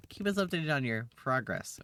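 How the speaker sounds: usual speaking voice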